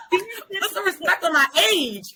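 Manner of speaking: high, slow, laughing through it; giggling sassy demand. Pretend. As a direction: stretch it out while laughing. Repeats the joke, laughing.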